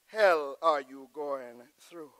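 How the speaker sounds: normal talking voice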